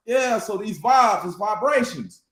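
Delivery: usual speaking voice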